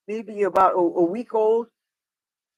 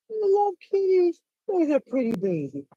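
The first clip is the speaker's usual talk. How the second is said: high cooing voice; 9 semitones higher than usual. Real, not acted. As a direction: coo at the cat. Cooing at a cat.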